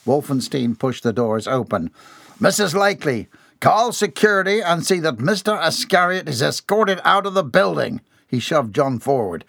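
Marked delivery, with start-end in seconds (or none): 2.4-8.0 high, forceful summons; angry command. A narrator's reading. Boss telling secretary to call security, angry. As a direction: call out the order with authority.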